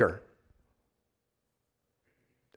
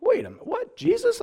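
squeaky high disbelief at Jesus; hammed-up gasp of disbelief; mock shock; 8 dB quieter than usual. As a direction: jump up in fake astonishment. Mock shocked listener reacting.

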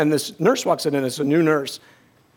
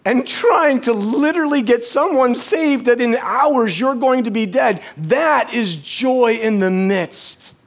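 raised pitch, slow emphatic climax; impassioned preaching; 6 semitones higher, slower than usual. Real, not acted. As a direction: drive the point home with drawn-out fervour.

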